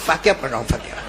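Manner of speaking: a smoker cornered by a jinn answers in fear; scared mumble. Acted. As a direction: mumble it like a terrified man caught out. Frightened smoker answers softly.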